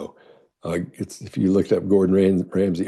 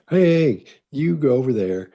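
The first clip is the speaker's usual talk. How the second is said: higher, louder call directing people; 6 semitones higher, 3 dB louder than usual. Shown as a demonstration. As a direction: call out to steer people where to go. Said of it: "You may say".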